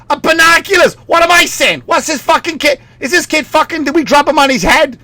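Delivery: very high, ranting dad impression; exasperated Boston dad. Dad and son fighting over gift, shrieky mock anger. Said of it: "then the dad's like".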